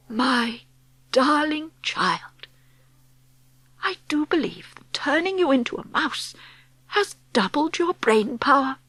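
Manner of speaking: awed tenderness. Acted. Grandmother staring at her clever grandchild, soft marvelling. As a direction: pause between words in hushed admiration.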